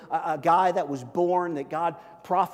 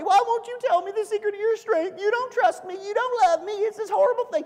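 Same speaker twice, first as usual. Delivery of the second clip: very high, hammed-up wailing complaint; mock tantrum, pitched way up; pity party; 4 dB louder than usual. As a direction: whine shrilly as if wounded and unloved. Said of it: "a pity party".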